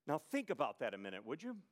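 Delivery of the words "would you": ordinary talk, nothing marked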